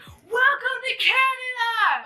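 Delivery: high, drawn-out holler; calling out. Retold or imitated. Re-enacting her shout to the band onstage, high drawn-out call. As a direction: holler it slowly across a silent crowd.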